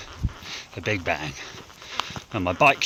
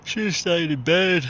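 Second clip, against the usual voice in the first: mock weary groan; 8 semitones higher, slower than usual. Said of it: "I was like".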